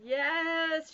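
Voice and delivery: high, loud, scratchy ghost voice; spooky ghost howl. A narrator's reading. Bean pretends to be a ghost to scare Ivy, scratchy howl. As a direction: howl the word out like a haunting spirit. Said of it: "made her voice scratchy and spooky".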